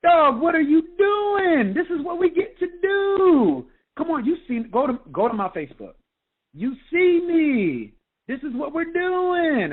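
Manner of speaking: hammed-up high, drawn-out sponsor pep talk; Super Mario impression. Sponsor recruiting me, in a high, slow, loud put-on voice. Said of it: "it's my best Super Mario impression".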